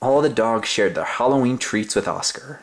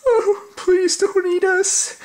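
very high, slow pleading cry; terrified plea; 3 dB louder, slower than usual. Acted. Frightened dogs begging the monster, high slow plea. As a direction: beg for your life in a tiny high voice. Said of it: "cried the dogs".

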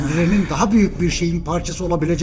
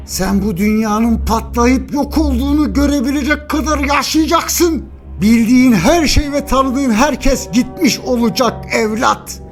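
impassioned angry tirade; 7 semitones higher than usual. Acted. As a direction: hammer it home in a raised pitch. Father berates beaten son.

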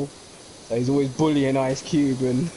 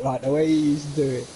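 ordinary talk

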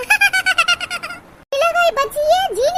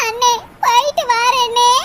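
slow, crying goodbye, drawn out; hammed-up sob as she leaves her brother; mock tearful farewell; 3 dB louder, slower than usual. (அண்ணனிடம் விடைபெறும் மெதுவான மிகை அழுகைக் குரல்)

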